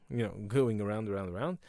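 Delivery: ordinary delivery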